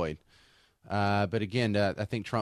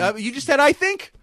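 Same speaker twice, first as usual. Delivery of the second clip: surprised; 13 semitones higher, 7 dB louder than usual. Real, not acted. Catches cohost using "I think.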